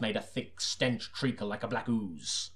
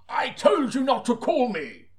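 high, slow, loud bellow; bellowing chief constable; 12 semitones higher, 7 dB louder, slower than usual. Acted. Chief constable bellows at Seaton for calling him. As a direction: bellow it down the phone at the detective. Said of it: "he bellowed".

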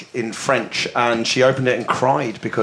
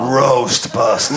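movie trailer voice; 2 semitones lower, slower than usual. Pretend. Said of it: "answer after that in the movie trailer voice".

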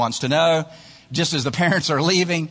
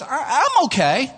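high, noncommittal hedge; offhand shrug; 10 semitones higher than usual. Acted. Artist's modest reply in ad.

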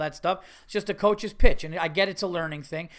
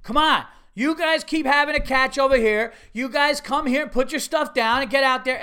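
loud, high-pitched coach hollering; bossy coach; 7 semitones higher, 7 dB louder than usual. Retold or imitated. Coach hurrying kids onto the field, loud and pushy. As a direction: holler orders like an overkeen coach. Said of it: "one of the coaches is like".

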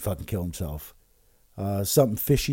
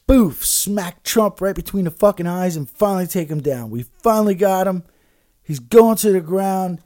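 higher, louder hammed-up victory cry; mock triumph; 7 semitones higher, 6 dB louder than usual. Host mocking Schiff's hoped-for takedown, in hammed-up triumph. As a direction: ham up their gloating.

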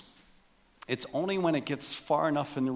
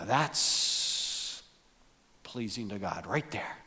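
whisper; 5 semitones lower, 7 dB quieter, slower than usual. Pretend. Mock pious verdict, whispered.

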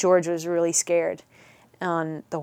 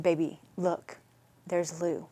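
put-on hushed, tender reassurance to a child; reassuring; 8 dB quieter than usual. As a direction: soothe a frightened child, dropping to a soft near-whisper. Comforting her scared son on horseback.

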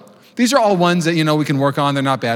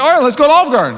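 high, louder, cheerful mom voice; eager mom; 10 semitones higher, 4 dB louder, faster than usual. Retold or imitated. Indulgent mom agreeing to Olive Garden, high and cheerful. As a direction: happily give in like an indulgent mother. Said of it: "she's like".

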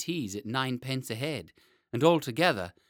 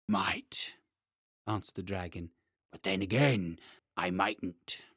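growling dragon guarding treasure; 5 semitones lower than usual. Acted. Dragon muses on escape.

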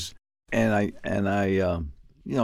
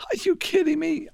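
much higher-pitched retold outburst of disbelief; incredulous outburst. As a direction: fire back the disbelief in a high burst. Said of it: "my Puerto Rican came out of me".

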